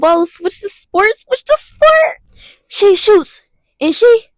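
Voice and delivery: slow, rising to very high and loud commentary; mock sports announcer. Switching to basketball, playing a loud hyped announcer. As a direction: call it like a hyped announcer building up.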